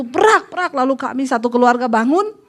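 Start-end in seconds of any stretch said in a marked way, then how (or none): 0.0-0.7 higher crashing noises; spoken crash effect; imitated crash. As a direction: mimic a car hitting the ground. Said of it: "merasa mobil kami jatuh".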